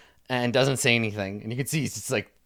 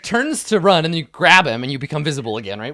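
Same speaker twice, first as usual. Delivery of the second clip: normal talking voice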